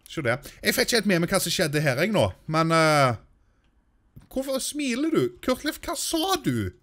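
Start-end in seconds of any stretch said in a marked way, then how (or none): 4.3-6.7 higher-pitched mock telling-off of a Sim; mock scolding. Gamer mock-scolding his Sim for not being angry. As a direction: scold him like an exasperated parent.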